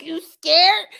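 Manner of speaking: loud, high mocking taunt; taunting scare actor. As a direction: sneer it right in her face like a haunt actor. Actor taunting her at haunted hayride.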